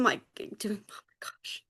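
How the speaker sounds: real choked-up near-whisper; overcome with emotion. Real, not acted. Overcome while sharing with friends, choked up. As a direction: voice gives out, barely any sound. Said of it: "Why am I getting emotional?".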